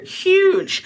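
Huge said high and loud; emphatic huge. Acted. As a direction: punch the word Huge. Emphasizing monster size.

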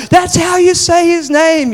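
high, loud, drawn-out mock yell; outraged classroom protest. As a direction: cry out like an indignant class of kids.

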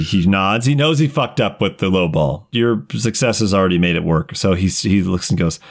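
normal talking voice

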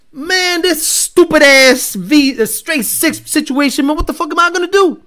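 high, loud mock exasperation; Herman griping. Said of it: "Herman is like".

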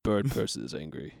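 low, deadpan bird-man voice; mock-solemn low impression; Bird Person. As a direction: speak in a deep, measured monotone. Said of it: "does he talk like this?".

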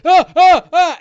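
high, loud panicked ah ah ah; terrified orc. Acted. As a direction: squeal like a cornered orc. Cowering orc cries out.

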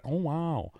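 celebrity-impression wonder; Owen Wilson. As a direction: imitate a famous actor's awed wow. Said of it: "Doing her own Wilson voice".

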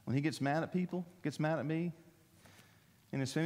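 ordinary talk

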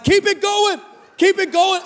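high, cheering chant; excited urging. Retold or imitated. Followers urging the storyteller on, excited and high. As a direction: egg them on in a high voice. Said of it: "they would message me".

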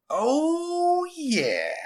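high, loud drawn-out relish; smug kid savouring his win; gloating schoolboy. A narrator's reading. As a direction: crow it, sliding down from high. Boy relishes bargain in story.

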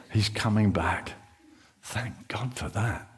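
low, soft, half-whispered relief; relieved hush. Acted. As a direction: play a shaken disciple calming down. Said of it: "That's Simon Peter talking".

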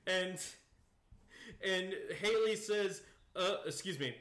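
ordinary talk, nothing marked

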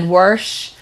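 "Warsh" with an r, Pennsylvania style; Southern Pennsylvania accent. Retold or imitated. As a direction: imitate Pennsylvania locals saying "Warsh", drawn out. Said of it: "the way they say".

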